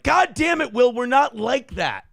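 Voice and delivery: high, startled mock protest; hammed-up outrage. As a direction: play scandalised at a joke. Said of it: "and I'm like".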